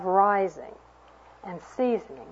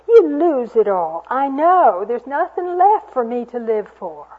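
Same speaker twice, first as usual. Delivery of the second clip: put-on louder, trembling old woman; a hopeless old woman's plea; shaken voice; 4 semitones higher, 6 dB louder than usual. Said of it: "her voice was shaken".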